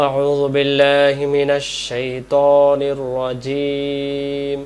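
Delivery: slow, drawn-out chanted recitation; Quran recitation. Shown as a demonstration. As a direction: recite it slowly in tajweed. (ধীর তিলাওয়াতের সুরে)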